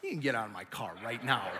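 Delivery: low, soft mock threat; low-voiced dismissal. Pouting husband sending wife out of car, low and curt. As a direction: drop it quietly in put-on irritation.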